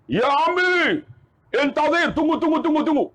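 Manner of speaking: high, insistent call to stop someone; mock frantic flagging-down; urgent hailing.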